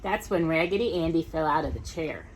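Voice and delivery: ordinary delivery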